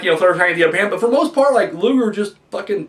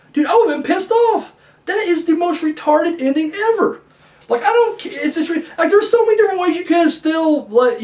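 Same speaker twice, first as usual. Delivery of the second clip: put-on young angry fan; 9 semitones higher than usual. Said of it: "young [name]'s state of mind".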